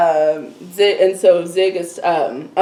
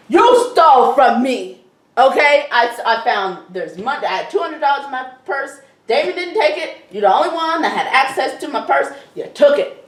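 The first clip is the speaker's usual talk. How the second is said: mock angry accusation; 6 semitones higher than usual. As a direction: act out storming in to accuse. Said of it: "She storms in".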